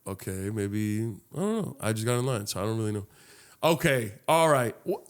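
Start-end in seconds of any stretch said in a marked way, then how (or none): 0.0-3.1 low, soft thinking to himself; hesitant musing. Retold or imitated. Bystander in line deciding not to make a scene, murmured hesitantly. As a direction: mull it over quietly, unsure.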